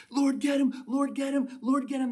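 high-pitched, agitated mock plea; worked up. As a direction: act out David egging God on, high and urgent. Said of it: "he's, like, all worked up".

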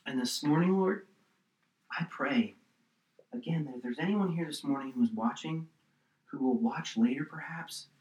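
soft, unhurried prayer voice; closing prayer. Real, not acted. Pastor praying over the congregation, softly. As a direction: pray gently for the listeners.

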